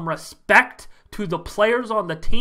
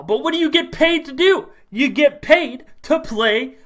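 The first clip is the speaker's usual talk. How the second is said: normal talking voice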